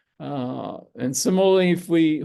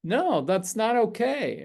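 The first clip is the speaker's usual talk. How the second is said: higher-pitched, firm boundary-setting; lightly acted assertive refusal, pitched up; mildly fierce; 7 semitones higher, slower than usual. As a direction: say it as a firm refusal, without shouting. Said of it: "fierce and say".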